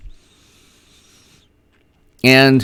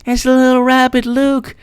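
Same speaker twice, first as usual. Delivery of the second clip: very high, cutesy voice of wonder; retold delighted cooing; 12 semitones higher than usual. Retelling how they showed Luke and Eric a rabbit, high mock-innocent cooing. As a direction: coo over a little animal, playing it up. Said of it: "and I'm like".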